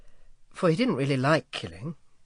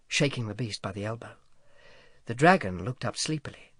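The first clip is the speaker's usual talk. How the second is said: plain reading voice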